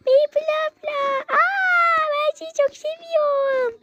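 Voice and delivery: high-pitched adoring fan squeal; starstruck fan. Acted. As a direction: gush like a fan meeting an idol. Starstruck fan meets vlogger.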